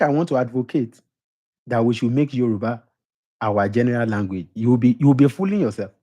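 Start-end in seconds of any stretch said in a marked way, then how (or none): none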